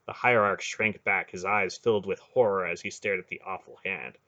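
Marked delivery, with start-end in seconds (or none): none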